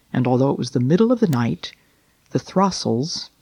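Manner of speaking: plain reading voice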